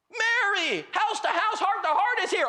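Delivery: high, loud delighted announcement; hammed-up excited holler; excited husband. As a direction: imitate a man yelling thrilling news to his wife. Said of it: "Oh, this is exciting".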